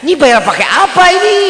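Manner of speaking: high, loud comic panic; exasperated mock wail; retold bewilderment. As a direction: act out being overwhelmed by the bill. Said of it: "Kan makin pusing saya".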